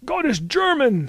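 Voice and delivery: very high, loud proclamation; loud cry joining a quarrel; rival outcry. A narrator's reading. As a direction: top the others with a high shout. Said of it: "said another".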